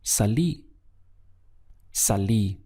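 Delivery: salí with the weight on the closing í; stress on final í. Shown as a demonstration. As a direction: land on the last syllable of salí, sa-LÍ. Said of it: "important to stress this accent over the I".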